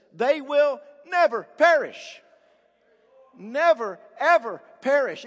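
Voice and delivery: NEVER and EVER punched out loud; emphatic. Real, not acted. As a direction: hammer NEVER and EVER, each word standing alone. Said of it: "It's emphatic here".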